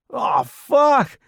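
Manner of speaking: high, loud cry of alarm; alarmed shout. A narrator's reading. New soldier cursing as the bomb explodes, alarmed shout. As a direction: shout a startled curse.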